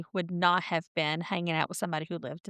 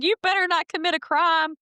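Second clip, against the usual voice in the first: louder, higher-pitched quote of a scolding dad; amused, raised-voice imitation of a dad's warning; quoted movie dad; 9 semitones higher, 4 dB louder than usual. Retelling the dad's party line to a co-host, amused imitation.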